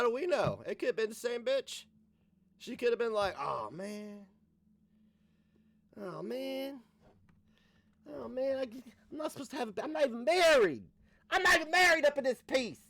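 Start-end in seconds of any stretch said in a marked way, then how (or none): 3.4-9.8 higher-pitched comic moan of dismay; groaning "oh man", hammed up; dismayed Mary. Voicing Mary dismayed at pregnancy. 9.8-12.8 high, loud comic protest; exasperated, raised-voice complaint, played for laughs; mock panicked outburst. Acted panic of unmarried pregnant Mary.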